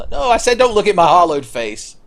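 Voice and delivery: high, loud protest; mock protest. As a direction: cry out in pretend embarrassment. Voices ashamed hollow character.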